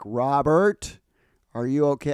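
put-on calling loudly through a door, no accent; loud call into the men's room; retold yell. Lady yelling into the bathroom for Robert, loud call. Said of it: "there's a lady yelling into the bathroom".

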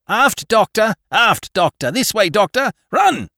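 high, loud calling shout. A narrator's reading. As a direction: call out over the chaos. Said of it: "the captain was shouting to me".

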